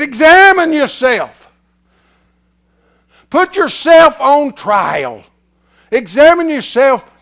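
loud, slow proclaimed verse; thundering pulpit reading; preaching scripture. Acted. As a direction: lay the verse on them with force.

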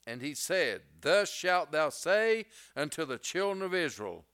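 preaching at full force. Acted. Preacher to congregation, proclaiming God's name to Moses, booming then hushed.

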